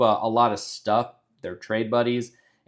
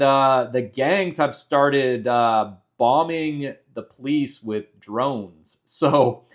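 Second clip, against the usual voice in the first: usual speaking voice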